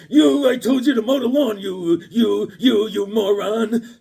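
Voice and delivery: loud, high mock scolding; hammed-up yelling, sputtering on each "you"; wife yelling. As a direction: imitate a furious wife berating her husband. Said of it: "he's getting yelled at by his".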